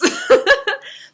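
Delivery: high, loud squeal of laughter. Real, not acted. Cracking up at the wands with no faces, a high squealing laugh. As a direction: burst into a shrieking laugh.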